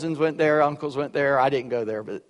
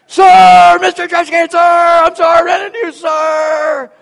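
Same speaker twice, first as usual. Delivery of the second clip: full-throated high bellow, even louder; yelled louder; 14 semitones higher, 12 dB louder than usual. Retold or imitated. Cadet made to repeat his apology, all-out yell. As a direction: yell it at the top of your lungs. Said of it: "yells it again".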